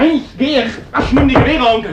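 struggling shout. Acted. Son to his mother and uncle, wrestling free: straining shout. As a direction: yells it while pulling away.

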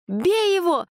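high shrill shriek. A narrator's reading. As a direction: shriek it in panic. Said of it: "взвизгнула Света".

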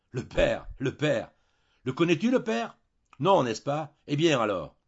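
explode, out of patience; furious. A narrator's reading. Husband mocking the unknown father to his wife, furious. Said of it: "comme à bout de patience et furieux".

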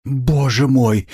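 drawn-out, high cry of dismay. A narrator's reading. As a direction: exclaim slowly, pitched high, aghast. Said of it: "вскричала Пульхерия Александровна".

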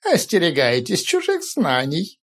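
slow, solemn prophecy; prophetic trance voice. Acted. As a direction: warn slowly like an oracle. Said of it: "голосом прорицателя сказал О Каролан".

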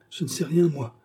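high-pitched frightened protest; begging for mercy. A narrator's reading. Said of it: "Il balbutia, demanda grâce".

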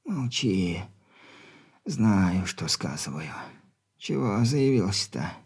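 weak stern dying father; very old man. Acted.

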